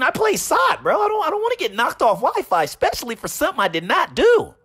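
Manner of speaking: squeaky mock pleading, offended bro; voicing Referred. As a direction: play the wronged gamer, whiny and high. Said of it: "it's like".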